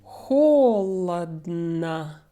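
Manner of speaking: "холодно" drawn out as HO-luhd-na; slow demo of "холодно", later vowels reduced; three different O sounds. Shown as a demonstration. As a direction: stretch "холодно" slowly, stress on the first O. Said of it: "pronounced a little bit differently".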